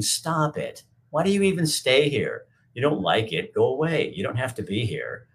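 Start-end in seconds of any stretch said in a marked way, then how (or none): 0.0-4.1 high, pushing exasperation; exasperated scolding. Real, not acted. Streamer orders a heckling viewer to stop, high and exasperated. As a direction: shoo him off like a pest.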